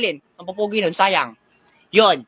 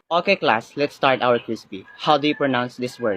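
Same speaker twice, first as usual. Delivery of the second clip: composed teacher, low-pitched and softer; 8 semitones lower, 4 dB quieter, slower than usual. Acted. Teacher opens the pronunciation quiz for the class, low formal voice. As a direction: address the class in a low, measured voice.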